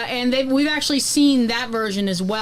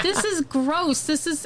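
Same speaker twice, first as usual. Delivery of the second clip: disgusted; 5 semitones higher, 3 dB quieter, slower than usual. Retold or imitated. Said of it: "I was like".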